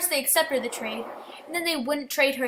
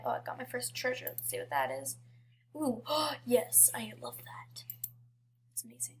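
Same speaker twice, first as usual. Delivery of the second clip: very soft, half-whispered delight; quiet murmur fading to a whisper; hushed aside; 8 dB quieter than usual. Real, not acted. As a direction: drop to a near-whisper, as if to yourself. Murmured aside while playing the game.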